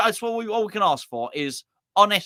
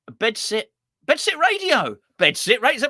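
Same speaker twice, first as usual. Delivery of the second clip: high, louder radio-jingle call; excited announcement; 8 semitones higher, 5 dB louder than usual. Pretend. Presenter hailing viewer Bedsit Radio, excited jingle call. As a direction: call it out like a station ident.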